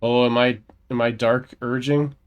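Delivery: usual speaking voice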